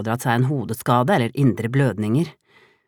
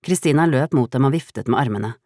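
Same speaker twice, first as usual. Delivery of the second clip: plain reading voice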